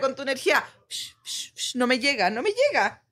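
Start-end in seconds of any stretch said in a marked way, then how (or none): 0.9-1.5 whispered psh, psh pushing energy away; psh blocking sound. Shown as a demonstration. Daughter showing mom how to block energy, whispered psh sounds. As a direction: brush off bad energy with soft psh sounds. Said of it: "hazla así, mira".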